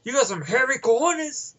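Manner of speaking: movie character quote. Retold or imitated. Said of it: "When he goes".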